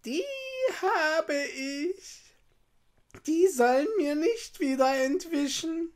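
high, put-on mocking witch voice; witch's malicious sneer. A narrator's reading. Witch spotting Hänsel and Gretel, sneering maliciously. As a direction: sneer like a gloating storybook witch, pitched high. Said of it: "lachte sie boshaft und sprach höhnisch".

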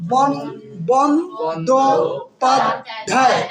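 "Bandyopadhyay" in four slow, separated beats; syllable by syllable. Shown as a demonstration. Teacher counting syllables for students, "Bandyopadhyay" split with gaps. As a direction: break "Bandyopadhyay" into বন, দো, পাদ, ধ্যায়. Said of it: "কয়টা আছে".

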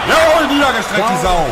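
hoch und laut jubeln; jubelnder Ruf. Real, not acted. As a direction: triumphierend aufschreien. Jubelt über Treffer. (jubelnder Ausruf)

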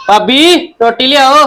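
drawn-out call for food; calling his sister-in-law to bring food. Acted.

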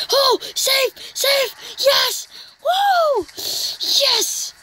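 high, thrilled yells of safe and woo; excited cheering. Real, not acted. Gamer cheering as his runner scores safely, high excited yells. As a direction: cheer the runner home like you can't believe it.

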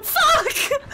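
high, loud swear; shouted curse. Real, not acted. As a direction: curse as you get caught. Caught by killer.

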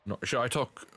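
attempted Australian slipping into British; halting, botched British accent. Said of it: "I started saying it in a British accent".

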